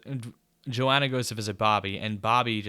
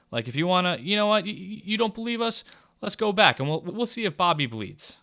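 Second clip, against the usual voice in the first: higher, taunting mock menace; mocking men's association; 8 semitones higher than usual. The town men taunting Joanna, lightly hammed-up menace. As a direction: sneer at her like the smug town men. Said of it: "everyone's like".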